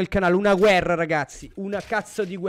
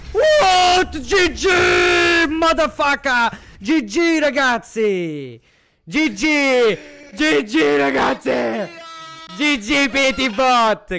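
ecstatic shouting; 4 semitones higher, 7 dB louder, much slower than usual. Real, not acted. Caster celebrates tournament win.

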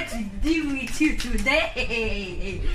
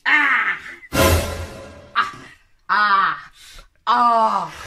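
loud anguished cries; shocked wailing; 4 dB louder, much slower than usual. Acted. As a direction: wail as if heartbroken.

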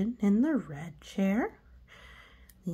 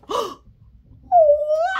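high loud rising gasp; excited gasp; 13 dB louder than usual. Acted. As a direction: gasp in delight. Pigeon sees bus.

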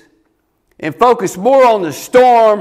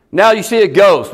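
ordinary talk, nothing marked

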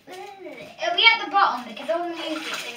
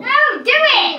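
insistent shout; 6 semitones higher, 6 dB louder, slower than usual. Real, not acted. Daring sister to get in.

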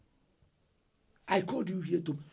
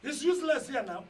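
angry dismissal; 9 semitones higher than usual. Acted. Eldest son shouting to let his brother walk out.

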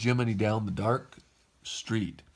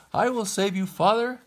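high, raised rescuing call; 10 semitones higher, 5 dB louder than usual. A narrator's reading. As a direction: call out high to someone in danger. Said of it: "called Pinocchio".

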